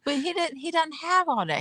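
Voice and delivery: ordinary talk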